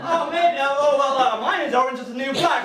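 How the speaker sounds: high, loud, faltering small talk; straining to rein in. Acted. Comic answering about Netflix while restraining himself, strained casual. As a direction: stumble into forced casual chat, still worked up. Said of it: "have to try and cave in that in".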